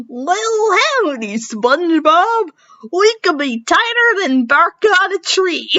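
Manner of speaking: high Texan cartoon squirrel drawl; cartoony Sandy Cheeks. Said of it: "with a cartoony Sandy Cheeks imitation".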